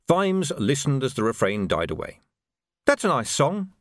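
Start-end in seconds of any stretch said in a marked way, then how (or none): 2.9-3.7 higher, youthful voice; young Sam. A narrator's reading. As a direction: innocent young recruit admiring the tune. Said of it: "said young Sam".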